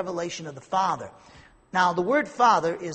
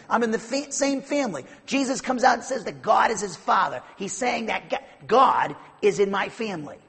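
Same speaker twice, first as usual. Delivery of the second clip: loud, higher, driving sermon delivery; preaching at full force; 4 semitones higher, 6 dB louder than usual. Acted. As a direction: preach it hard to the congregation. Preaching the deity of Christ.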